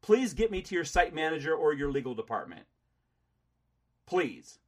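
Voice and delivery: loud, high angry insisting. Real, not acted. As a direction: demand it furiously. Recounting while shouting at agent.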